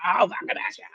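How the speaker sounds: high-pitched retold menace; mock aggressive threat. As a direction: imitate an aggressor making threats.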